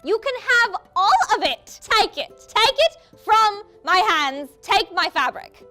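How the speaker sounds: over-generous Rarity. Retold or imitated. Sarcastic Rarity giving away everything.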